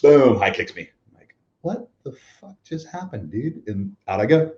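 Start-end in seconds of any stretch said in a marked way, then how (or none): 1.6-3.6 stunned disbelief. Retold or imitated. Stunned after surprise kick.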